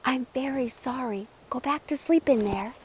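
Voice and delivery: soft, hushed apology; soft whisper. Acted. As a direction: murmur it gently to the egg. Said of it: "whispered softly".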